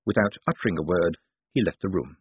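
ordinary delivery, nothing marked

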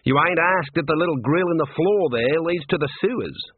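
leading, chatty warder voice; prompting warder. Acted. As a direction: nudge the prisoner with a heavy hint.

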